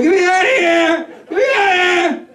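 high, strained cry for help; trapped, desperate cry. Acted. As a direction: yelp like someone trapped under a weight. Voices a mattress trapped like person.

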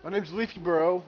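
big loud YouTuber intro; announcer voice. Pretend. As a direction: boom out a channel intro. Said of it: "I'm kidding".

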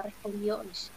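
ordinary delivery, nothing marked

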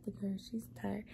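unvoiced soft whisper. Real, not acted. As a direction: whisper so the cat can rest. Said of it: "whispers near sleepy cat".

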